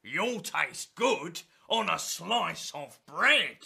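loud, slow, hammed-up monster; a greedy beast licking its lips; Gruffalo voice. Gruffalo threatening the mouse, loud and slow.

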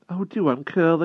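lightly voiced boy urging the hedgehog; coaxing exclaim. A narrator's reading. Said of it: "exclaimed the wart".

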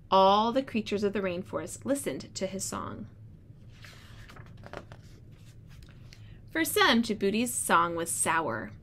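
storybook read-aloud voice; reading to children. Acted. Teacher reads story aloud.

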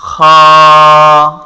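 খা with throat friction and long vowel; loud, drawn-out, level খা; model kha pronunciation. Shown as a demonstration. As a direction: hold খা steady after a rasped kh. Teacher models the Arabic letter.

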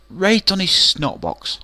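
slow, high, loud repeat of the punchline; slow emphatic punchline. Real, not acted. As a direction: drag it out for the laugh.

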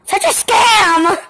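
loud, slow, high indignant cry; outraged squeal. Real, not acted. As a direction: wail at the unfairness. Outraged at missing toy.

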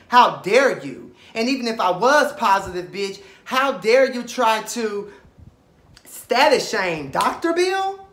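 loud, high, rising rage; furious. Real, not acted. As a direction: tell him off, building fury. Furious rant at Markel.

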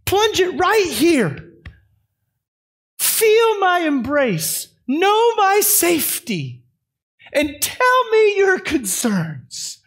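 put-on slow, high, gentle welcome to a child; a father gently welcoming his child into his arms; slow, tender voice of God the Father; tender invitation. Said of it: "He says".

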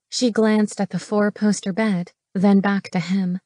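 usual speaking voice

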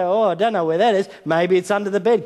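high pretend cluelessness; father teasing hiding daughters; mock guessing. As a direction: play along as if you can't find them. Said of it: "and say".

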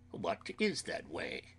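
hoarse, hushed man's question; hoarse Van Helsing. A narrator's reading. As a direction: ask it in a hoarse character voice. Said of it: "asked Van Helsing in a hoarse voice".

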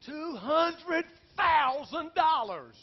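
high, loud, slow drawn-out wail; anguished outcry. Retold or imitated. Pastor cries to God about the money, slow loud wail. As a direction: stretch each word in despair.

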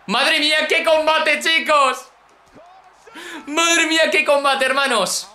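high-pitched, held-out exclamations; amazed excitement. Real, not acted. Gamer to his viewers about the match, amazed.

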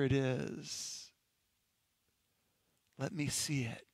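hushed, pleading near-whisper with long pauses; soft, halting murmured prayer. Acted. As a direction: plead softly to God under your breath. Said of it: "you might even find yourself whispering".

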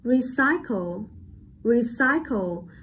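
recycle slowly with CY stressed; light re, then lean on CY in recycle; slow, careful model of recycle, middle syllable strongest; stress on second syllable. Shown as a demonstration. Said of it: "đánh trọng âm rơi vào âm tiết thứ hai".

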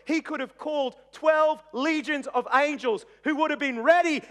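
loud, high, driving sermon delivery; impassioned preaching. Real, not acted. Preaches at full force.

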